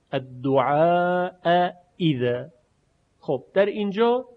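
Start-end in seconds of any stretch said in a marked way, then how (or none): 0.1-2.5 الدعاء اذا with a long aa, then two separate full hamzas; both hamzas pronounced. Shown as a demonstration. Teacher showing two hamzas meeting across words, both hamzas fully pronounced. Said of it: "همزهٔ اول مفتوح".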